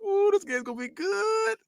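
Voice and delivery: high, soft, slow ooh of delight; giddy anticipation. Retold or imitated. Kid reading a stolen-look manual, giddy anticipation. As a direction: coo it high and drawn out.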